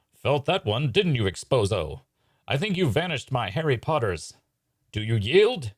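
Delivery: theatrical taunting duelist; triumphant magician. Acted. Hydini demanding the Great Exposo yield, triumphant taunting. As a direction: taunt your beaten rival like a stage magician.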